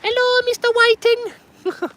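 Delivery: very high and very slow cartoon hello; falsetto greeting. Angler greeting the bait fish in a squeaky voice. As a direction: stretch it out in a squeaky voice.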